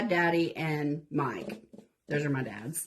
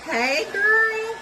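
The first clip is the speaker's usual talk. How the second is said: excited greeting; 5 dB louder than usual. Real, not acted. Greeting Sandy in the chat, excited and loud. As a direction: greet her brightly.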